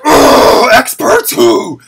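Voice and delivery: loud, high ranting outburst; exasperated shout. Retold or imitated. Streamer replaying his outrage at the experts, exasperated shout. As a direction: burst out loudly, dragging the words.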